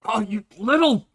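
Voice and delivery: startled, irritated snap; annoyed outburst. Acted. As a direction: caught off guard and annoyed. NPC splashed with a drink.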